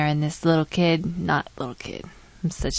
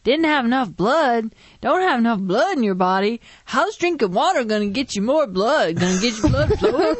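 put-on a higher, louder nagging old man; older man, insistent; 6 semitones higher, 3 dB louder than usual. Nosy older gentleman at the clinic, pressing loudly. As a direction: lightly play a nosy older man who won't drop it. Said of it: "He was like".

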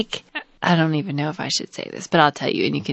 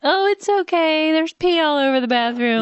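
much higher, sweet mock-calm voice; 10 semitones higher than usual. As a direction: fake cheerful reassurance. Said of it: "I should have just been like".

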